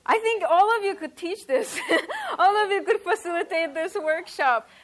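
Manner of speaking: laughing. Real, not acted. Laughing praise of audience.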